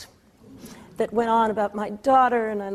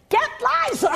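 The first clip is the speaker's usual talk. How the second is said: high mock yell from the front row; 11 semitones higher than usual. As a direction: shout it across the theater. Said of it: "keeps yelling".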